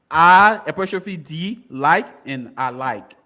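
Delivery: slow, careful, each part separate; slow spelling out. Shown as a demonstration. Quizmaster spelling the answer for contestants, slow and careful. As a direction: spell it out slowly so all hear. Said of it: "Speaking to the".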